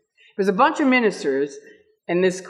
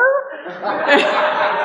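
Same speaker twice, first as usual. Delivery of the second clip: laughing; 7 semitones higher than usual. Real, not acted.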